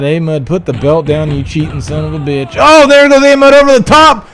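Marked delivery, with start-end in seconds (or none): 2.6-4.3 excited play-by-play. Acted. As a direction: call it like the match is on the line. Play-by-play of an elimination.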